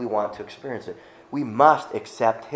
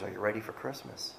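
softer, asking it the way that matters; are you ready, gently and softer; quieter, searching; 12 dB quieter than usual. Shown as a demonstration. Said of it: "in the way that it really matters".

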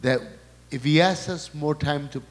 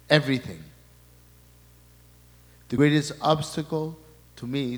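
normal talking voice

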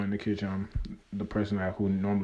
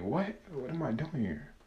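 surprised; 6 semitones higher, 4 dB quieter than usual. Retold or imitated. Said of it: "I'm like, I'm like".

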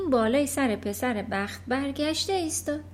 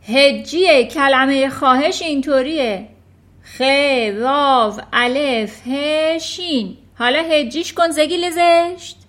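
shouting; 3 semitones higher, 10 dB louder, slower than usual. Acted. Trunchbull screaming at Eric over his spelling. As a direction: bellow insults at the trembling boy.

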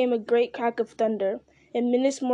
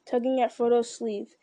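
usual speaking voice

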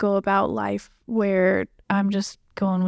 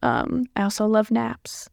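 normal talking voice

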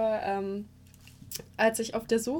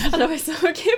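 laughing; 5 semitones higher, 8 dB louder, much faster than usual. Real, not acted. Laughing retelling own reaction.

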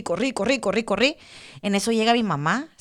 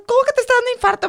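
high, loud, rapid alarm; startled high-pitched outburst, fast; alarmed mother; 5 dB louder, much faster than usual. Retold or imitated. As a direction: imitate a mother shrieking in disbelief. Retold mother's alarmed reaction.

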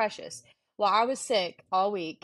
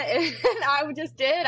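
laughing through the words; 7 semitones higher, 3 dB louder, slower than usual. Real, not acted. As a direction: giggle it out. Laughing while retelling.

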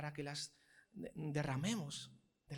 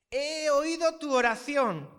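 slow, higher, louder declaration; proclaiming God's words; 8 semitones higher, 10 dB louder, much slower than usual. Acted. God answering Hezekiah, slow loud proclamation. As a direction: announce it with full force.

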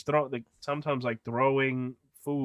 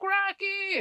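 very high mock girlish call; imitated girl calling. Ditched Emily calling Rocky, imitated high-pitched cry.

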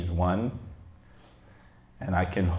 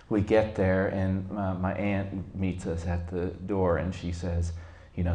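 normal talking voice

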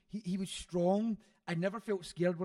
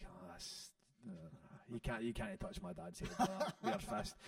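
whispered; 10 dB quieter than usual. Retold or imitated. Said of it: "I was like".

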